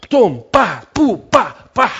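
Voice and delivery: a string of loud tum pa pu pa blows; punching noises. Acted. Preacher miming blows breaking the man's heart, loud punches. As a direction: beat out each hit sharply.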